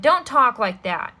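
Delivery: loud upset plea; angry. Acted. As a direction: shout him down. Girl shouts at villain.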